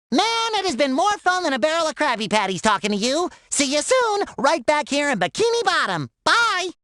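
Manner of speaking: high, bubbly farewell; cheery sign-off. Acted. Character says goodbye to the player, cheery sign-off. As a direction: wave them off brightly, ending on a high punched goodbye.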